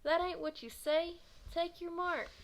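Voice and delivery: high, loud heckle from the crowd; crowd yell. Acted. A kid in the crowd corrects the starter, yelling. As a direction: correct him at the top of your voice. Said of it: "someone in the crowd yelled".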